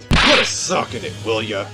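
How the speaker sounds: loud, high burst of irritation; angry shut-up. Acted. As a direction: shout them down in a quarrel. Telling rival to shut up.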